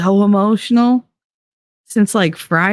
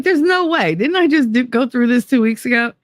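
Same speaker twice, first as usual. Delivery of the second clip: retold disbelief; 6 semitones higher than usual. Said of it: "I was like".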